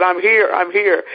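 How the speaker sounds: high-pitched retold call. Winner calls from the back of the room, calling out. Repeats her call to judges.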